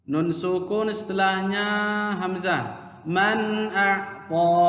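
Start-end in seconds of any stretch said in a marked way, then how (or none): 3.1-4.7 "Man a'ta" chanted very slowly, clear n; correct recitation. Shown as a demonstration. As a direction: recite "Man a'ta" with a drawn-out last vowel. Teacher recites correct example. ("Man a'ta" dilantunkan pelan dan jelas)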